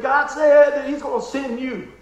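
slow, loud and weighty, each word placed; slow solemn message. Retold or imitated. Old neighbour telling the pastor God will send him, slow and solemn. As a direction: deliver it like a prophecy, slowly.

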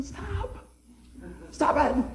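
high, soft, coy voice; mock-embarrassed little plea; bashful protest. Son answering his bragging dad, bashful protest.

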